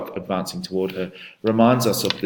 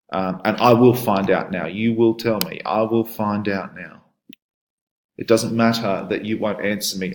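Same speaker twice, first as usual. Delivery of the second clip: reading the husband's demands aloud, a bit louder; dramatic poem reading; 2 semitones higher, 4 dB louder than usual. Shown as a demonstration. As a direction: read the poem with force, as a threatening husband. Teacher reads poem dialogue aloud.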